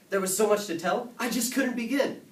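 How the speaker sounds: very high, loud, excited burst; bursting with excitement. A narrator's reading. Boy with so much to tell Dad, highly excited.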